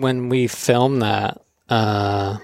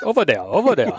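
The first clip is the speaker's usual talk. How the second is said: mock Hawaiian pidgin; 5 semitones higher, faster than usual. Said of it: "everyone was just like".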